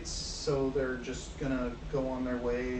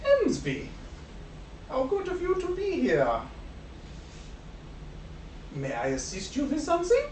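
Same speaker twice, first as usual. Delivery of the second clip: Bert addressing Hamsby; 11 semitones higher than usual. Acted. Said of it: "he goes".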